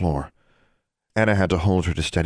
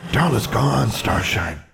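ordinary delivery, nothing marked

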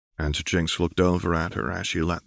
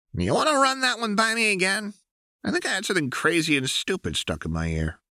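put-on much higher, fast, sarcastic young woman; Jinx's mocking voice; faster than usual. A narrator's reading. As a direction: play it bratty and incredulous. Jinx mocks the nomination.

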